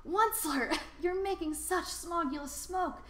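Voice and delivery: an indignant little creature choking on smoke; croaky Lorax. A narrator's reading. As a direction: Lorax croaking a complaint about the smog. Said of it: "he cried with a cruffulous croak".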